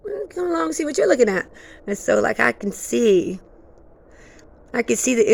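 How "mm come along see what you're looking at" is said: coaxing spirit voice. Retold or imitated. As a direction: lure them along gently, pitched high.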